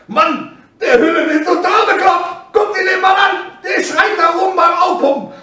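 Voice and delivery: high, incredulous exclaiming; imitated shocked onlookers. Onlookers watching him scream backstage, high astonished exclamation. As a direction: marvel at a madman. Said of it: "Und jeder hat gedacht".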